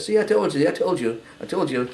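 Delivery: mock snotty told-you-so, first high; wife gloats that he came home singing, lightly snotty. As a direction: imitate a smug wife crowing. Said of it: "was kinda snotty".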